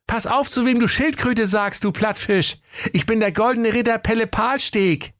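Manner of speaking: high, cheeky retort. Acted. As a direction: sass him back, high and proud. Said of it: "rief keck".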